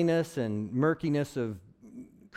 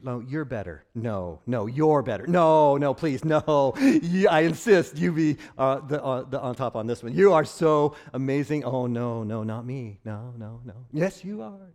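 louder, hammed-up back-and-forth insisting; mock humble insisting; 7 dB louder than usual. Pastor acting out two meek people outdoing each other, hammed-up loud insisting. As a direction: overdo the polite "no, you're better". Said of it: "meekness is having that same attitude".

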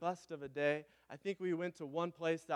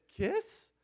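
much higher, sweet asking little voice; imitating toddler; 12 semitones higher than usual. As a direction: squeak it like a small child asking.

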